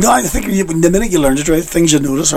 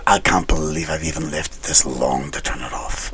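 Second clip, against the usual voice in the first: low quiet driver voice; 12 semitones lower, 10 dB quieter than usual. Retold or imitated. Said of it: "And he said".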